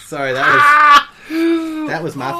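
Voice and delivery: laughing. Real, not acted. Apologizing through laughter.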